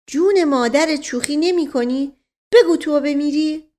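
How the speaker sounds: high-pitched anxious disbelief; worried astonishment. A narrator's reading. Mash Qasem questions his townsman in the basement, worried and astonished. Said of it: "با نگرانی و تعجب پرسید".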